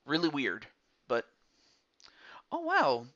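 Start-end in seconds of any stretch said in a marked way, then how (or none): 2.5-3.1 surprised. Real, not acted. Reacts to a song request.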